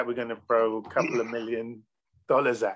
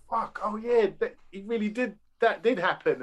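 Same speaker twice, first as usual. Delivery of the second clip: surprised realization; 7 semitones higher than usual. Retold or imitated. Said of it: "and you go".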